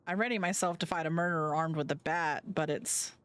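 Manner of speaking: normal talking voice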